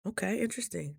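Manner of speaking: note it quietly, don't react; soft, curious okay interesting; hushed curious whoa. Retold or imitated. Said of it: "I was like".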